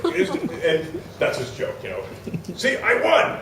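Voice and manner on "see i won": high-pitched mock belligerent crowing; belligerent demand. Psychic claiming the prize from host, mock belligerent shout. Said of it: "he gets really belligerent".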